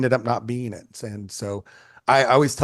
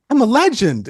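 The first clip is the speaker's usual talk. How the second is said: high, loud crow of pride; hammed-up bragging cry; mock boasting; 12 semitones higher, 6 dB louder than usual. Photographer bragging to his brother on the phone, mock boastful.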